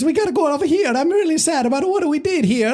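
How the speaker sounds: high, cartoonish Italian plumber voice; squeaky mock Mario, going on and on. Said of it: "do you really wanna listen to an entire movie of".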